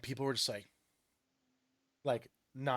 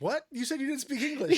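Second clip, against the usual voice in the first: mock disbelief; 11 semitones higher, 4 dB louder than usual. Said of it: "indignant retort in story".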